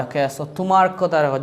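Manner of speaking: ordinary talk, nothing marked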